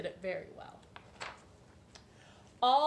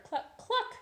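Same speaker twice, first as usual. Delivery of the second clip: clucking; 13 semitones higher, 4 dB louder than usual. Acted. Hen clucking.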